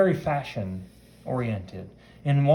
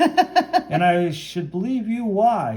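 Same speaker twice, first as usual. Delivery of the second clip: higher, louder, slow mock disbelief; skeptical; 9 semitones higher, 6 dB louder, slower than usual. Woman doubts the hero's claim, played-up skepticism. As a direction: challenge him, drawing it out doubtfully. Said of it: "she's skeptical of him".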